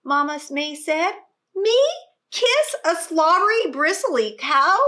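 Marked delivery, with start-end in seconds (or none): 1.5-4.8 loud, much higher, disgusted protest; child protesting loudly to her mother; outraged cry. Acted. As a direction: recoil at the very idea. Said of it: "cried Annalisa".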